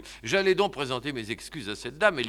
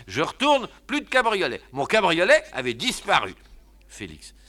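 ordinary talk

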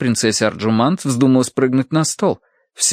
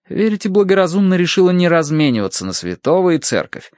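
ordinary delivery, nothing marked

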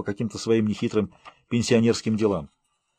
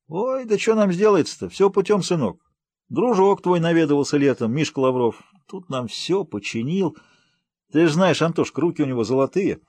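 put-on higher, animated country old-timer; talkative village uncle; 6 semitones higher than usual. Uncle Vanya tells Anton the village news, high and lively. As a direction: chatter away like a folksy villager.

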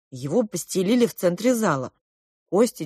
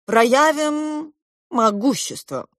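slow, high, stretched-out proclamation; grandiose king; 6 semitones higher, slower than usual. A narrator's reading. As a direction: draw it out like a pompous monarch. King grandly giving orders.

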